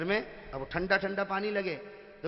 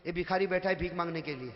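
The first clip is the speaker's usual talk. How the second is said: ordinary delivery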